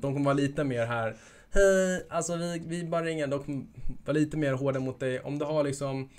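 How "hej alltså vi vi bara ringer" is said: a hint of a Stockholm accent, higher; mock Stockholm accent with a loud opening hej; light Stockholm accent imitation. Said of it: "om det är Stockholms accent".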